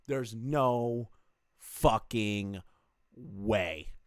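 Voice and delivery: THERE'S NO, FUCKING, WAY broken up by pauses; retold disbelief, spacing out "no fucking way"; emphatic disbelief. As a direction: land "fucking" and "way" each on its own, with a pause before. Quoting own disbelieving reaction, word by word.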